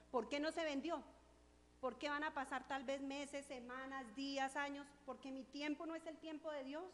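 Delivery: loud, full-force preaching. Real, not acted. Preacher tells the congregation, preaching loudly. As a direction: drive the testimony home to the church.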